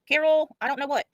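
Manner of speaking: usual speaking voice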